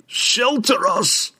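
high, drawn-out wail for shelter; delirious wail. A narrator's reading. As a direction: wail desperately for refuge, very slowly. Delirious dwarf relives the disaster.

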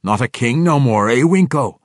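high, loud gruff declaration; commanding dwarf. Acted. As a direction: announce yourself from behind. Bruner speaks.

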